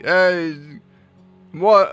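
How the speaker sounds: ordinary delivery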